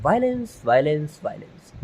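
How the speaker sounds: slow, drawn-out hero punchline; imitating a film hero's slow dialogue delivery; Rocky Bhai dialogue. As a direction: stretch each violence like a swaggering film hero. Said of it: "फिर वो dialogue देते हैं".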